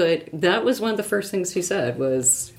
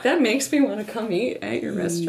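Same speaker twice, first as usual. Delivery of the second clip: ordinary talk, nothing marked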